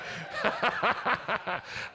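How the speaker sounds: a breathy amused laugh. Real, not acted. Pastor laughs as the congregation answers wrong, an amused laugh. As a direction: laugh it off lightly.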